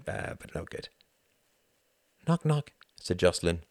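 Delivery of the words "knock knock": put-on child voice. A narrator's reading. Girl Jocelyn voiced.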